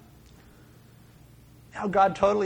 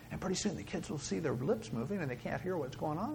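very soft, confiding voice; hushed; 11 dB quieter than usual. Shown as a demonstration. Teacher trick to quiet noisy kids, hushed. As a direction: drop right down like a whispering teacher.

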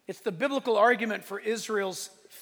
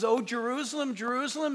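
ordinary talk

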